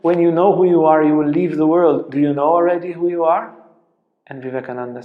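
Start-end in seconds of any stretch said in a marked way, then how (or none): 0.0-3.5 louder, quicker, teasing; a playful challenge to the teacher, quick and loud; playful teasing question. Retold or imitated. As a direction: toss it out playfully and fast. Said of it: "kind of playfully asks them".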